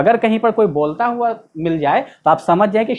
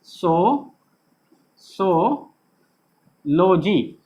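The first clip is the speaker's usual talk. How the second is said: so, so, lo-jee with long pauses between; broken into parts; 2 semitones lower, much slower than usual. Shown as a demonstration. Teacher splits the word for students, सो, सो, लोजी spaced apart. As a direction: say सो, सो, लोजी piece by piece. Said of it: "हम तोड़ के लिखें".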